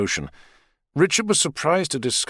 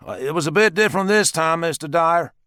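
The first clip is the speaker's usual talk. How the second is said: a higher, lightly voiced American man, polite; American man's voice; 8 semitones higher, 3 dB louder than usual. A narrator's reading. Richard politely greets his girlfriend's father, lightly voiced American. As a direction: address the father a bit stiffly.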